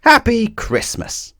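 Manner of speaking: high, slow, joyful call; excited cry. A narrator's reading. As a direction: announce the surprise gleefully. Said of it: "Little Miss Birthday cried".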